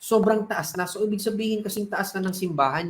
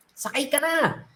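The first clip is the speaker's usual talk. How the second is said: shouting from helicopter; 6 semitones higher than usual. Acted. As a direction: yell over the rotor noise to get him aboard. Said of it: "sinisigawan siya".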